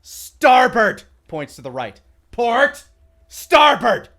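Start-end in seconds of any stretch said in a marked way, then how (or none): 0.0-1.0 high, loud drill-sergeant call; loud bark. Acted. Captain teaching directions loudly. 2.3-4.1 high, loud drill-sergeant call; loud bark. Acted. Captain teaching directions loudly.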